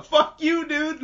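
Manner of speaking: high, loud mock taunt; mock defiance. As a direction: burst into a high-pitched playful jeer. Laughing, voices Inosuke's defiant boast.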